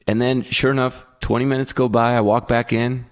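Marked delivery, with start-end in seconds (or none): none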